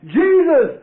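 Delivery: high, loud fervent cry; shouting Jesus. Retold or imitated. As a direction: call out the name with all you have. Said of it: "And I shouted".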